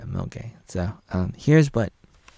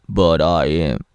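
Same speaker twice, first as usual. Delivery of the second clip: louder, low, gruff voice; mock gruff; 3 semitones lower, 8 dB louder, slower than usual. Vlogger parodying Flopper's contradiction, gruff loud.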